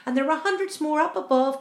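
usual speaking voice

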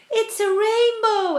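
high, loud, drawn-out cry; excited exclamation. A narrator's reading. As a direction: exclaim in delighted wonder. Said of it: "everyone said at once".

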